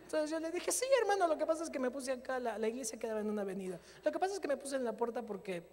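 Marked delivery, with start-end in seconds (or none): 0.7-2.4 nervous high reply. Retold or imitated. Awkward reply to usher.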